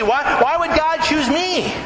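high, drawn-out bewildered question; imitating afraid. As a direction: play overwhelmed disbelief, lightly acted. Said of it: "Mary was afraid".

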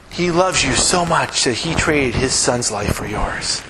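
normal talking voice

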